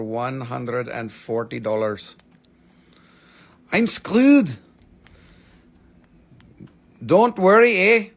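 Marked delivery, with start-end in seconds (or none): 3.7-4.6 high, loud whiny complaint; mock whiny buyer. Seller voicing a Canadian buyer, high mock whine. 7.0-8.1 high, loud Canadian eh; mock Canadian. Seller teasing Canadian viewers, mock Canadian accent.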